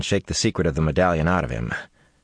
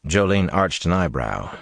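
plain reading voice

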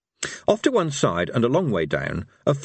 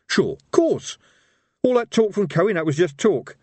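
high, insistent reassurance; slightly desperate; 7 semitones higher, 3 dB louder than usual. Acted. Evil Harry denying his old friend died, slightly desperate. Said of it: "a slight touch of desperation".

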